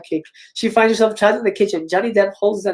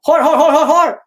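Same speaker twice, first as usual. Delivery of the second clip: louder, higher chanted taunt; mock taunting; 7 semitones higher, 7 dB louder than usual. Killer taunting the victim on screen, loud jeering. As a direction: jeer it again and again.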